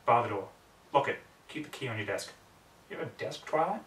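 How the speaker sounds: ordinary delivery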